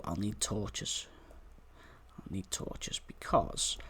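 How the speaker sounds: ordinary talk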